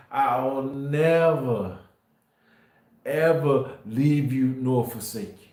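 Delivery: very slow, every word weighed; slow solemn promise. Real, not acted. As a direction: draw out the promise slowly and solemnly. Preacher quotes scripture solemnly.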